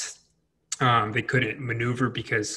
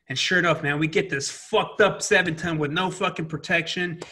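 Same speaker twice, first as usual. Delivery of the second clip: ordinary talk, nothing marked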